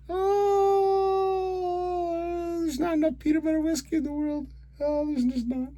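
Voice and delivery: high squeaky lament after a long "oh"; comic wailing. Real, not acted. Fan drowning the loss in whiskey, high comic wail. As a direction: moan it out high, half laughing. Despairing laughter over team's loss.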